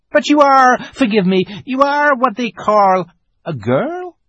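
very high, loud creature voice; flustered fawn. A narrator's reading. Fawn questions the strange girl, high flustered voice. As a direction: ask excitedly, apologising mid-question.

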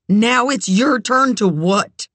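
distraught, high-pitched demand; trembling cry. A narrator's reading. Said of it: "she cried, trembling".

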